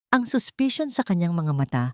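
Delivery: ordinary delivery, nothing marked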